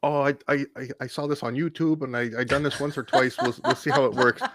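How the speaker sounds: high, laughing Vietnamese nurse teasing; mock the nurse joking in her accent, through laughter. Patient imitating the nurse teasing him, in accent. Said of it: "now she starts screwing with me".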